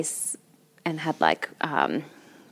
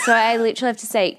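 usual speaking voice

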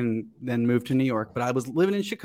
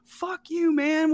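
mock an irritated comeback, pitched way up; retold annoyed brush-off; 13 semitones higher, slower than usual. Man retelling his snap at a passing driver, irritated and high-pitched. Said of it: "being like".

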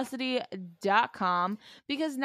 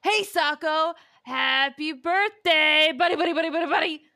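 loud, high, stretched birthday yell; yelling into phone; 6 semitones higher, 5 dB louder than usual. Retold or imitated. Spencer yells birthday wishes into the phone, loud and high. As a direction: holler it down the phone. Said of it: "dials the phone and yells".

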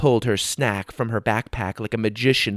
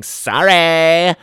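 loud, higher, gleeful yell; shouting; 8 semitones higher, 6 dB louder than usual. A narrator's reading. Tisha shouting while knocking pieces off the board. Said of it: "while shouting".

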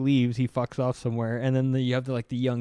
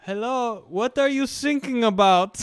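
高い声でドイツ訛り、thinkingをsinkingと; とぼけたドイツ人隊員のまね; German accent; 10 semitones higher, 5 dB louder than usual. Retold or imitated. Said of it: "But the guy's German".

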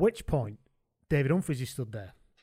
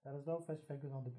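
put-on quick, quiet Irish accent by mistake; can't shake the Irish accent; stuck Irish accent; 17 dB quieter, faster than usual. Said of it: "locked in the Irish one now".

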